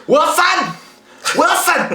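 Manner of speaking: high-pitched comic shout; mock desperate calling. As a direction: call out as if lost at sea. Calling out like lost at sea.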